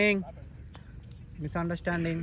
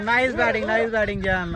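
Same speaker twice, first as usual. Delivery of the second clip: high, loud commentator cheer; excited cheer; 6 semitones higher, 7 dB louder than usual. Real, not acted. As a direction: cheer the shot loudly. Cheering good shot.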